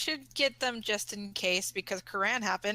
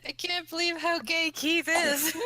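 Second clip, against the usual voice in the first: words bursting out through real laughter, higher and louder; cracking up mid-sentence; laughing; 5 semitones higher, 4 dB louder than usual. Real, not acted. To the cast about Keith, laughing helplessly.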